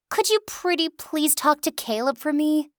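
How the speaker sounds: high sugary pleading voice; whiny pleading. Acted. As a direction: beg sweetly like a spoiled child. Lily begging sweetly.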